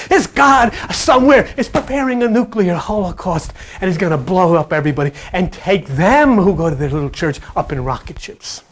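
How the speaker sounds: ordinary talk, nothing marked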